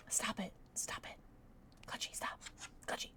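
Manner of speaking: soft whispered stop; whispered plea. Real, not acted. Whispering at Clutchy to stop. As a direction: beg him under your breath. Pleading with the chess piece.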